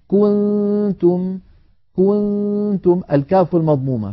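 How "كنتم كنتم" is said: كنتم slow and higher, damma of ك held; slow recited example. Shown as a demonstration. Teacher recites the example before showing the error. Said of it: "كذلك مثلًا الضمة".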